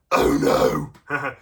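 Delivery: hammed-up loud oh no; mock alarm at the troll's fall; playful storybook gasp; mock dismay. Troll swept down the river.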